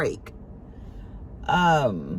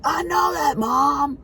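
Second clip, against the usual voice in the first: imitated yell; 11 semitones higher, 5 dB louder, slower than usual. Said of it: "he'd yell back".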